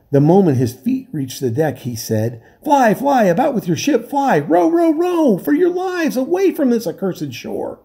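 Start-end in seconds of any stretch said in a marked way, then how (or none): 2.6-7.8 very high, frantic cry; terrified castaway urging the crew away; panicked warning. A narrator's reading. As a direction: scream at them to flee. Terrified man warns them.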